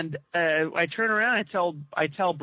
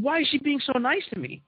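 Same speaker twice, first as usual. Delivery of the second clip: baffled question; 8 semitones higher than usual. Retold or imitated. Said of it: "So suspicious".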